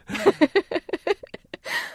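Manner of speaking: a short high burst of real laughter. Real, not acted. Telling a friend the strange ending, a high laugh. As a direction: laugh at how absurd the goodbye was.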